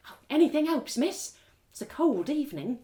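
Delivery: a lightly voiced street beggar asking; beggar woman. Beggar woman asks narrator for a copper, pleading.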